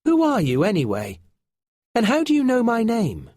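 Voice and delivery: put-on a wary young boy demanding answers; boy asking. A narrator's reading. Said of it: "Finn asked".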